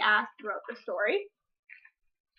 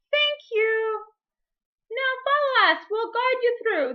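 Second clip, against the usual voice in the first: much higher, louder grateful bird voices; doves calling; 10 semitones higher, 6 dB louder, slower than usual. Acted. Freed doves thanking the fox and offering to lead him, high and bright. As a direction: sing out thanks as little doves.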